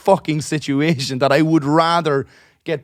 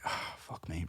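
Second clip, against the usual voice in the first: under-breath dismay; 8 semitones lower, 19 dB quieter, slower than usual. Retold or imitated.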